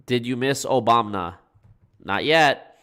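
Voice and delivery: normal talking voice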